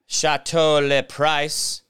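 slow, drawn-out mock French accent, savouring Chateau; hammed-up posh French accent on each word; mock fancy French. Naming his house like a French hotel, mock fancy French accent. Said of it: "sounded French".

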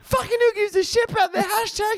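squeaky high mock outburst; hammed-up high-pitched ranting; mock younger self. Parody of their younger selves.

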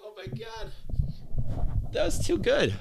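high squeaky laugh through words. Real, not acted. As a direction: giggle it out, can barely speak. Cracking up at robot voice.

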